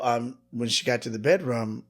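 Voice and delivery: normal talking voice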